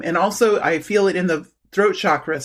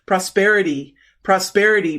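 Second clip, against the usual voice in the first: ordinary delivery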